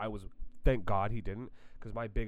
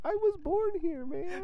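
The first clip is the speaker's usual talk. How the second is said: much higher, strained, whiny squeak; mock whiny protest; 4 dB louder than usual. Speaker answering the bus bully, high whiny mock protest. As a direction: squeal it like a helpless victim. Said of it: "I'm like".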